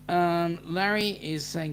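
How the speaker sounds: ordinary delivery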